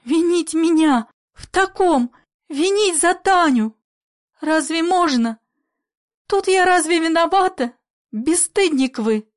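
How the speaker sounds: high, loud wounded protest; loud, trembling voice. A narrator's reading. Polya defends herself from blame, loud trembling voice. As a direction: cry out the accusation, pitched high. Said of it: "громко дрожащим голосом".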